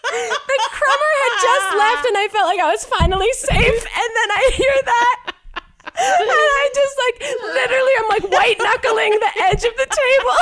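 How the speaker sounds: high-pitched laughing while speaking; words squeezed out through laughter. Real, not acted. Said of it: "full mouth scream with no noise coming out, laughing".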